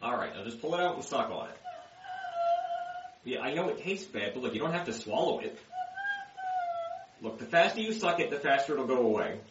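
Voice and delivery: soft hushed coaxing; quiet. Acted. Coaxing wife to suck cough drop.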